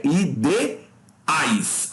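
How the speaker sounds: ideais very slowly, split i-de-ais; syllable by syllable. Shown as a demonstration. Teacher corrects the division for students, ideais slowly in syllables. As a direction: stretch ideais into three separate syllables. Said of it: "como é que separa".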